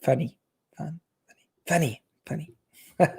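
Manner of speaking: whispered playfully under the breath. Real, not acted. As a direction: hush it to yourself, teasing. Playful repeating of the word.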